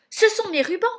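loud, high-pitched outrage; furious roar. A narrator's reading. Anastasie claiming her ribbons from Cinderella, roaring. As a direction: roar it indignantly. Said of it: "rugit Anastasie".

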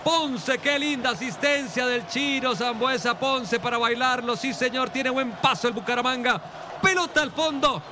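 high, excited celebration call; breakneck goal commentary. Acted. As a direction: keep the joy of the goal going.

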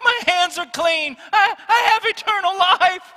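half-sobbing, half-laughing as he re-enacts the man's joy; retold joyful crying out. Dying man realises he is saved.

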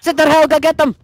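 excited shout in a put-on Irish brogue; frantic stage-Irish battle cry; mock Irish. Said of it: "speaking Irish".

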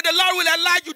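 high, fervent preaching voice; lightly voiced fervent preacher; mock preacher. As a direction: play the pastor blessing with zeal. Pastor voice building.

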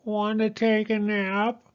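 high mewing cat. Acted. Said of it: "cried the cat".

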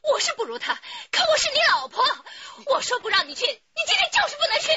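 very high, loud screaming demand; furious shout. Acted. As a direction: forbid him at the top of your voice. Wife forbids husband to leave.

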